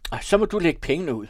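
high, loud, fast and cross; gruff angry shout. A narrator's reading. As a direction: bark it out impatiently. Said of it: "råbte Arthur bistert".

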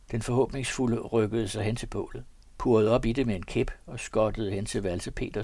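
ordinary delivery

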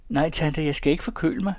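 calling out. Acted. Girl calling back to her aunt from the hall, high and loud. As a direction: call back through the house. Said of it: "råbte hun".

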